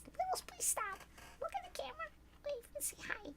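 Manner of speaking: high, soft pet-talk voice; talking to cat. Real, not acted. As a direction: coax the cat sweetly.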